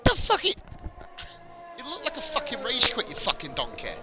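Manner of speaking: angry telling-off. Real, not acted. Keeps scolding the chatter.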